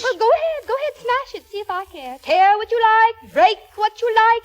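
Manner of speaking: high, defiant dare; defiant outburst. Acted. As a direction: throw it back at him, daring him. Wife daring husband to smash vase.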